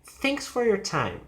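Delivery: emphasis on "Thanks", then down to "time"; falling intonation. Shown as a demonstration. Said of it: "emphasis is thanks and then goes down".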